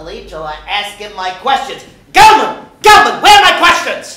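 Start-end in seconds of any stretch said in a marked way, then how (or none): 2.1-4.2 shouted, much higher and louder; calling out. Acted. Regis calling for his assistant Gelman, shouting. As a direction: yell for your assistant offstage.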